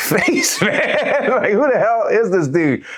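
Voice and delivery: high, breaking into laughter; laughing incredulously. Real, not acted. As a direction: crack up while retelling it. Breaks up laughing mid-story.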